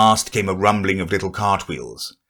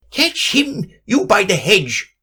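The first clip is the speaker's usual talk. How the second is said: high shout of the rabbit; the rabbit yelling orders; 12 semitones higher than usual. Acted. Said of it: "the rabbit's voice alone".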